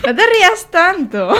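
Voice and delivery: high-pitched plea through giggles; helpless laughter in the words; laughing. Real, not acted. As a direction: crack up while telling them off. Breaks down laughing mid-explanation.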